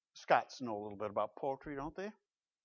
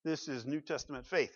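ordinary delivery

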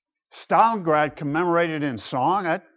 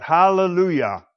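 louder, raised Hallelujah; ringing cry of praise; crying out again; 3 semitones higher, 4 dB louder than usual. A narrator's reading. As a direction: call it out as the crowd cries once more. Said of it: "Once more they cried out".

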